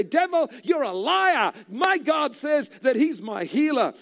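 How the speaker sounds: high, slow shouted rebuke of the devil; rebuking the devil. Acted. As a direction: shout down the liar with conviction. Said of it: "expose his lies and say".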